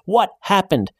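panicked shout at his wife's side. A narrator's reading. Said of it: "he shouts".

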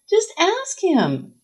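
high, stretched-out plea; insistent urging. A narrator's reading. Sarah urges Nora as she hurries out, insistent. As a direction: draw it out high, calling after her. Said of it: "Sarah says to the back of Nora's head".

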